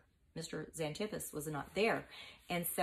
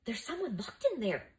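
higher-pitched excited realization; 6 semitones higher than usual. A narrator's reading. Mucius hears croaking from the wardrobe, excitedly. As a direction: say it with sudden excitement. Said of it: "said excitedly".

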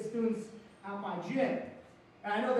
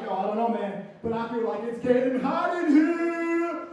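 hyped-up friend; 2 semitones higher, 9 dB louder than usual. Acted. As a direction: belt the end out like a party hook. Nelly's reply, ending in song.

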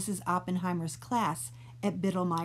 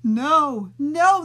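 loud, higher alarmed shout; 7 semitones higher, 11 dB louder than usual. Acted. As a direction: stop him with a loud cry. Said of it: "the three shouted".

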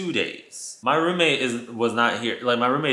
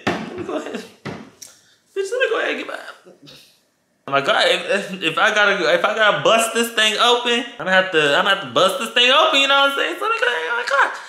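psyching up; 9 semitones higher, 4 dB louder, faster than usual. Retold or imitated. Steeling herself, high voice.

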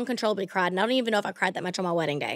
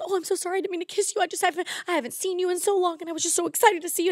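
high, soft imitation of an overexcited fan; apologetic gushing fan; 8 semitones higher, 4 dB quieter, faster than usual. Imitating the fan excusing his kiss, high gushing apology. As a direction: plead breathlessly in a small, high mock voice. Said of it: "and he goes".

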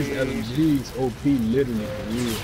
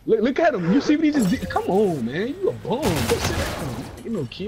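mocking trash talk, higher pitched; taunting; 7 semitones higher than usual. Real, not acted. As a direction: rub it in his face. Trash-talking an opponent's play.

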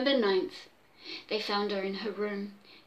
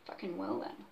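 muttered aside; 5 semitones lower, 13 dB quieter than usual. Real, not acted.

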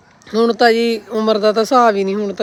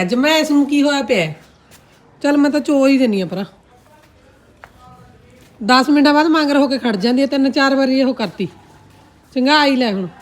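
exasperated scolding; 6 semitones higher, 4 dB louder than usual. Real, not acted. Scolding the restless cow while milking.

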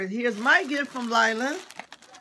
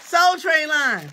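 drawn out, louder hype call; slow, louder announcer call; 4 semitones higher, 7 dB louder, slower than usual. Acted. Calls out the Soul Train line.

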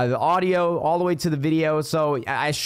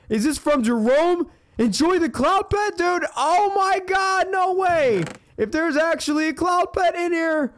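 excited; 10 semitones higher than usual. Real, not acted. Finds a long-wanted cloud pet.

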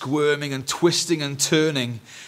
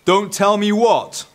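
loud, slow, raised boom; 10 semitones higher, 9 dB louder, slower than usual. A narrator's reading. Dad overhears from beyond the hedge, booming. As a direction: boom it out slowly across the garden. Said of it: "boomed the voice on the air".

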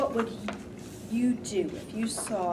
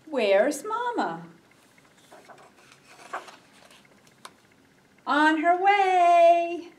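put-on reading to children; slower than usual. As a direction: read gently to little ones. Reads a picture book aloud.

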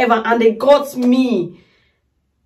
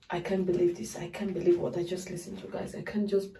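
much softer, lower disbelief; awed hush; 4 semitones lower, 15 dB quieter, faster than usual. Real, not acted. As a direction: fade into a stunned hush. Overwhelmed, trailing off.

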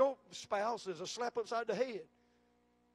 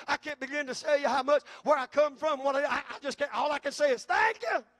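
louder and louder until the thanks bursts out high; building to a shout; 3 semitones higher, 9 dB louder than usual. Acted. Preacher voices overwhelmed believer.

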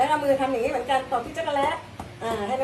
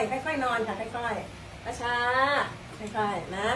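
soft, very slow coaxing; gentle coaxing; 6 dB quieter, much slower than usual. Real, not acted. Daughter slowly and softly helps her elderly mother lie down.

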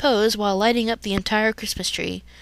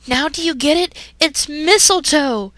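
urgent exclamation; 7 semitones higher, 5 dB louder than usual. Acted. Misty pointing out mistletoe.

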